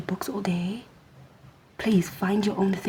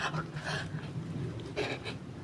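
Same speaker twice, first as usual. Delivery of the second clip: mock scandalized gasping; shocked gasp; 12 dB quieter than usual. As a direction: gasp as if horrified, imitating nosy watchers. Mocking onlookers' shocked reaction.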